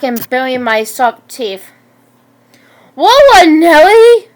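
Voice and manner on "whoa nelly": loud, high alarmed holler; screaming. A narrator's reading. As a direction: scream it out, drawing back in alarm. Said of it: "Mister Palmer screamed".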